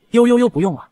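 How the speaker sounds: high, loud teasing mimicry; the tiger mimics him cheekily, high and loud; mocking imitation. A narrator's reading. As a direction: parrot him back in a cheeky sing-song. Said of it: "贱兮兮地学他说".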